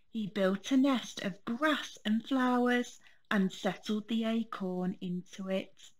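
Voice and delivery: ordinary delivery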